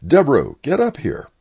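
loud, high-pitched summoning call; yelling down. Retold or imitated. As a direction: call someone up sharply from the floor below. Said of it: "and I yelled down".

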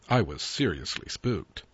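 plain reading voice

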